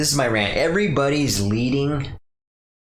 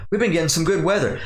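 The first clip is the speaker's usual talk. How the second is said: mock small talk; 8 semitones higher, faster than usual. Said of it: "it was boring enough when people used to say".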